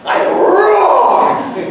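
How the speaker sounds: slow booming lion roar. Acted. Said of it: "let me play the lion too".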